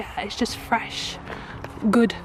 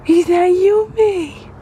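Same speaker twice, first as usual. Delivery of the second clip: high, slow cooing question; drawn-out baby talk; 7 semitones higher, 8 dB louder, slower than usual. Acted. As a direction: stretch it out for the baby. Asking baby twice.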